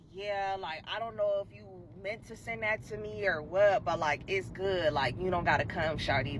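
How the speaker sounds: low quiet shrugging brush-off; cool dismissive guy. Retold or imitated. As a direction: mumble it low like he doesn't care. The guy voiced dismissing her.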